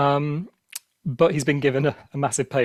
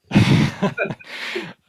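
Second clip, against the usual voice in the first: breathless laugh; 7 semitones higher, 6 dB louder than usual. Real, not acted. Laughing at the Irish impression.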